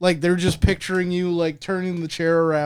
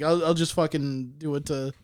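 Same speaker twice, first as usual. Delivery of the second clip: ordinary delivery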